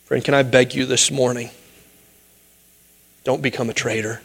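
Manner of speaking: ordinary delivery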